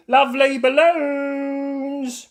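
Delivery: slow, high, loud sales cry; drawn-out seller call. A narrator's reading. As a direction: stretch out the call. Pedro selling.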